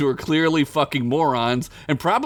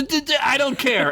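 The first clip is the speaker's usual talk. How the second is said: high, curt cutting-off; snapping; 13 semitones higher than usual. Acted. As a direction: cut him off before he finishes. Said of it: "snapped off".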